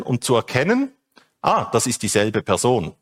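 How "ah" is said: ordinary talk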